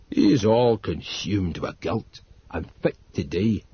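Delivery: slow grave Northumbrian; heavy Northumbrian gloom. A narrator's reading. Old servant refuses to tell grandfather, slow Northumbrian. Narrator voices Dodd.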